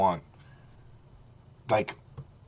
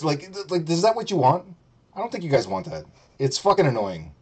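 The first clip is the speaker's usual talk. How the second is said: ordinary talk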